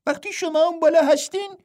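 high, light twin voice finishing his brother's line; a playful twin monk pitched well up. A narrator's reading. Twin Ping finishing the sentence. (صدای زیر و سبک راهب دوقلو)

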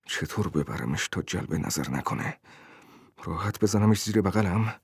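low, soft self-questioning; talking to oneself. A narrator's reading. Tenant wondering how to carry the package, musing to himself. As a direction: start very low, as private musing.

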